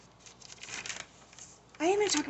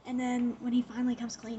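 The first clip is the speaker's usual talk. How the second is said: ordinary talk, nothing marked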